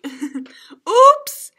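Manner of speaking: high loud surprised cry; startled little yelp; oops. Acted. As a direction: caught out, embarrassed. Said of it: "cried Froggy".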